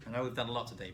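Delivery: ordinary talk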